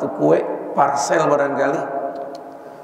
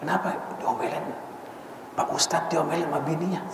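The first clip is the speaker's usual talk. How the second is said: gossiping neighbors; 10 dB quieter than usual. Retold or imitated.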